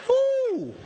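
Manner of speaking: sudden high squeal of surprise; a burglar's gleeful oooh; high mock ooh from the burglar; mock delighted ooh. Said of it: "he'd obviously gone".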